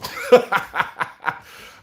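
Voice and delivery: loud breathy laugh; burst of laughter. Real, not acted. Host cracking up at his swearing joke, loud laugh. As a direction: laugh out at your own joke.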